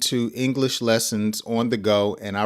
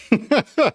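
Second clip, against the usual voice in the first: short laugh; 10 semitones higher, 3 dB louder than usual. Real, not acted. Laughs at own example.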